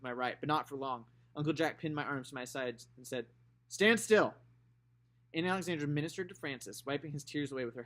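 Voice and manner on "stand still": stern command. Acted. Uncle Jack restraining Scout.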